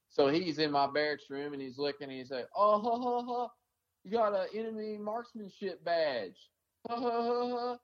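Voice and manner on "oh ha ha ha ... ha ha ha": mocking laughter. Sergeant in barracks room mocks the badge, high taunting laugh. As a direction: laugh it off at the badge like a smug jerk. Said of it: "Just fucking laughing".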